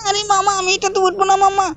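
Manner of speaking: put-on high, pleading little-boy voice; Shinchan child voice. Shinchan nagging his uncle, high childish voice. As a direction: pitch way up and whine at mama like a small kid.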